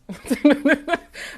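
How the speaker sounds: loud high burst of laughter; cracking up after calling humans dicks. Real, not acted. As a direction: laugh hard at your own joke.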